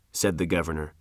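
ordinary delivery

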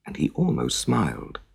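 ordinary delivery

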